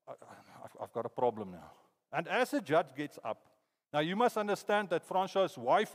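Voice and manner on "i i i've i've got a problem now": dazed dread. Retold or imitated. Sentenced to life.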